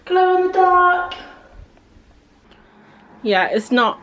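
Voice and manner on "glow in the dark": high, loud echoing reveal; echoey bathroom. Real, not acted. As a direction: call it out in the echoey dark. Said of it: "my voice is really echoey in here".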